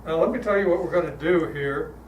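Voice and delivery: normal talking voice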